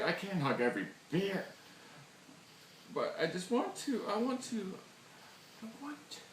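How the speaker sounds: mock tearful. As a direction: plead as if fighting back tears. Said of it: "Promised myself I wouldn't cry".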